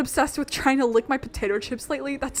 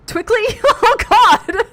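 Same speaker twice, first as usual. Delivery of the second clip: high, loud burst of laughter; real helpless cackling; cracking up; 13 semitones higher, 12 dB louder, slower than usual. Real, not acted. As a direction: blurt it out laughing at your own slip. Cracks up at own slip.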